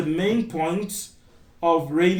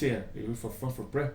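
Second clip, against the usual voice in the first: much lower and much softer, slurred mumble; imitating a local's fast, swallowed question; mumbled quick version; 6 semitones lower, 10 dB quieter, faster than usual. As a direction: swallow the words like a native speaker rushing.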